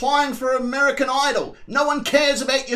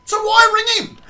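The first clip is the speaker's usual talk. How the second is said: loud; 11 semitones higher, 7 dB louder than usual. Real, not acted. Commentator mocking the driver.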